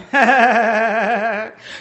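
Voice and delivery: evil cackle. Acted. Villain buzzard laughs.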